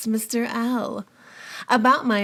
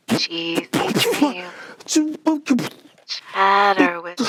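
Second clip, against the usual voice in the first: femme fatale; 3 dB louder, much slower than usual. Acted. Vamp voicemail sign-off.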